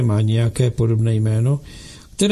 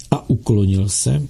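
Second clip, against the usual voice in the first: plain reading voice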